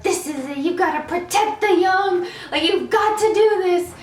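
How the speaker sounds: hammed-up singsong battle cry to herself; mock pep talk. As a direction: hype yourself up like a tiny warrior. Child pep-talking herself before attack.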